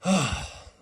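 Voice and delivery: loud, high huff; exasperated sigh. Acted. Girl tired of waiting for a name, exasperated huffing sigh. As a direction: heave a put-on sigh.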